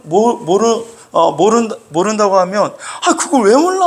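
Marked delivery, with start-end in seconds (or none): 3.1-3.9 high, loud burst of disbelief; exasperated anger. Acted. Preacher mimics someone faced with another's ignorance, exasperated anger. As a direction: snap at their ignorance, voice jumping up. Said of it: "그러면서 막 화를 내".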